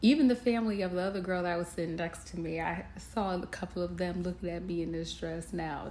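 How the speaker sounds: normal talking voice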